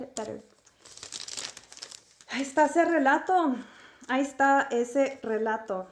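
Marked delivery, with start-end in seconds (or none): 2.3-3.6 higher, dramatic storyteller reading. Shown as a demonstration. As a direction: announce it like a title. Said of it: "repeats line differently".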